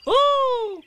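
very high, loud squeal; high-pitched excited ooh; excited Roo. A narrator's reading. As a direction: squeak it out like a thrilled little one. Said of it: "said Roo excitedly".

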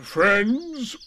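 slow, grand gloomy donkey voice; pompous Eeyore. Acted. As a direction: make a solemn speech, gloomy. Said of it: "coughed in an impressive way".

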